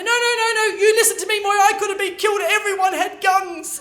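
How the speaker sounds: high, loud outraged yell, slowing at the end; angry shouting. Retold or imitated. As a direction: rant at her, furious and shaken. Brother keeps shouting accusations.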